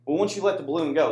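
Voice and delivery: normal talking voice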